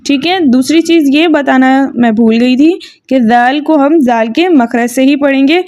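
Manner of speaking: ordinary talk, nothing marked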